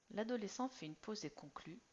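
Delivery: ordinary delivery, nothing marked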